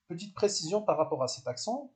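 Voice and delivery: ordinary talk, nothing marked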